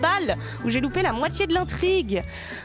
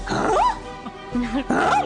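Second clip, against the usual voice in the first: incredulous; 8 semitones higher than usual. Real, not acted. Outraged reaction to the man.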